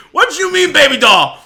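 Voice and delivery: loud, high furious yell; imitating angry customers. Streamer mimics an angry customer at the liquor store, shouting. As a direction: yell it like a customer ready to fight. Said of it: "they get so fucking pissed".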